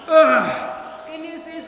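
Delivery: loud coaching call. Real, not acted. Coach cheering class through workout.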